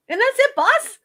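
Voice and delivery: high, loud cartoon sidekick sign-off; squeaky sidekick voice. Sidekick wrapping up for the host, high and loud. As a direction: hand it back to the boss in a squeaky voice.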